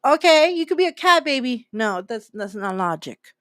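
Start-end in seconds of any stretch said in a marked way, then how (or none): 0.0-1.7 indulgent parent. Retold or imitated.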